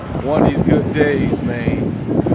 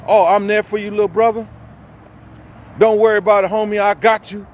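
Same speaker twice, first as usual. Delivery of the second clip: mocking fake friend; 6 semitones higher, 4 dB louder, faster than usual. Mocking fake-caring friends in a rant.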